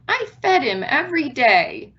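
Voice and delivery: lightly voiced sad child. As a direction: sound like a grieving child.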